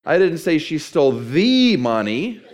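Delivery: slow down and stretch "the" into "thee"; stress on THE. Shown as a demonstration. Preacher showing how emphasis changes meaning, stress on the. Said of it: "if I change the emphasis".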